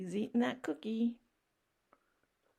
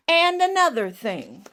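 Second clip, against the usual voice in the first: louder, higher indignant pigeon; louder ranting pigeon; 6 semitones higher, 11 dB louder than usual. Acted. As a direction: barrel on, louder.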